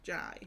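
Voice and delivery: J said as "Jai", rhyming with eye; "Jai" with a long i sound; Glaswegian Jai. Shown as a demonstration. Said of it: "pronounces, the letter J as in Jai".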